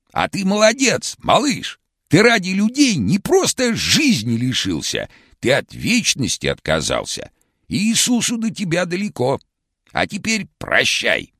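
very high, hoarse cartoon shout; vintage cartoon voice. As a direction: shout it like an old cartoon Karlsson. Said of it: "хриплым, как в старом мультике".

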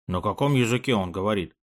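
usual speaking voice

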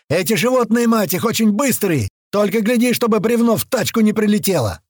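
high, sustained frantic shouting; exasperated yell. A narrator's reading. Корж warning about fast beasts, yelling. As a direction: keep hollering over the battle noise. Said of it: "взорвался мой наушник воплем Коржа".

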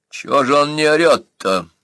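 a touch of impatient indignation; irritated master. Acted. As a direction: ask it as a master displeased with the show. Bursa demands why victim is silent.

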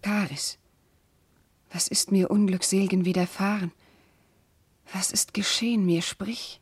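soft, breathless whispered plea; anxious whisper. Acted. Princess to her maid Karis, frightened whisper. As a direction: whisper it in dread.

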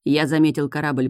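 ordinary delivery

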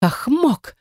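high-pitched indignant gasp; choking, gasping. A narrator's reading. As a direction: choke on the words. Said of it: "задохнулась она этими словами". (задыхаясь от возмущения)

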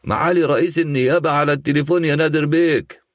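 high, fast proclamation in a ringing voice; booming announcement. A narrator's reading. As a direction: call it out like a court usher. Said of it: "قائلا بصوت جهوري".

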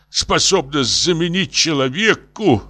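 pleading professor. Acted. Professor dictates to the wife, lamenting. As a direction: finish the drawn-out appeal.

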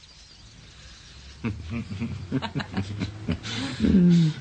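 a full, loud laugh; big amused laugh; hearty laugh. Acted. Friend laughs at tipsy couple.

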